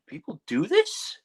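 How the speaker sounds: high, drawn-out disbelief; incredulous question. Retold or imitated. Retold disbelief watching death matches.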